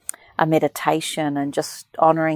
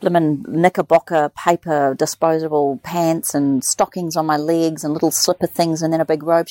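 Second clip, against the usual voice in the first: normal talking voice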